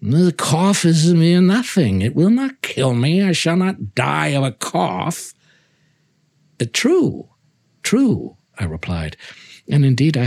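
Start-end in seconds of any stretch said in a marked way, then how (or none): 0.0-5.3 higher, proud voice brushing off the cough; a lightly voiced stubborn nobleman; Fortunato dismissive.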